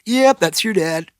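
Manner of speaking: high gleeful boast then laugh; proud dad laughing. Retold or imitated.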